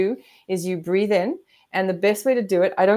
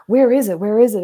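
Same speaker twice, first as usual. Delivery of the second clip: mock anxious; 2 semitones higher, 6 dB louder than usual. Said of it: "makes you go".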